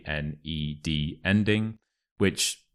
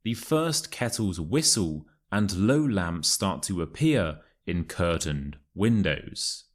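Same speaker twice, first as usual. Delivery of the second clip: model reading. Shown as a demonstration. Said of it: "listen to the passage and repeat after me".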